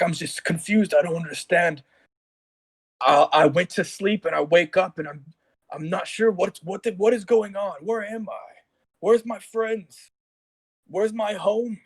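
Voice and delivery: bewildered Ferentis. Acted. Ferentis to the king, lost and frightened. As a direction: plead like a man who woke up alone. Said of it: "He's, he's in disbelief".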